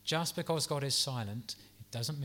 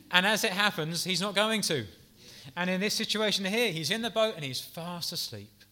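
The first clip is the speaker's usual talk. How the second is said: normal talking voice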